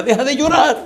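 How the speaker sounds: high-pitched imitation of a boy shouting; hammed-up high squeal; mock yelling. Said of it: "el tipo empieza a gritar".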